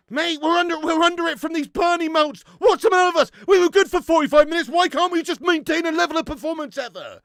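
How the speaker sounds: loud frustrated rant; angry rant. Real, not acted. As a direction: complain loudly at the team. Team under pressure, ranting.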